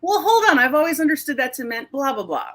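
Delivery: high, loud "wait, what?" interjection; puzzled audience proxy. Retold or imitated. Host cutting in on a guest to question him. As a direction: jump in as the confused listener. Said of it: "and I'll be like".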